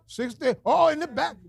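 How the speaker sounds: ordinary talk, nothing marked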